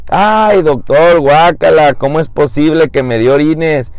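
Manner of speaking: high-pitched mock outrage; disgusted protest. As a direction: whine in comic revulsion to the doctor. Patient learns what he tasted.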